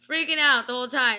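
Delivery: ordinary talk, nothing marked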